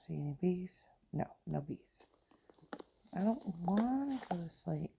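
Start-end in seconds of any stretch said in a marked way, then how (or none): none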